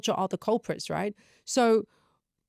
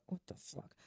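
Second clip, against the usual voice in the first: voiceless, very soft swearing; acted whisper of disbelief; whispered exasperation; 21 dB quieter than usual. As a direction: swear under your breath. Muttered reaction to small mishaps.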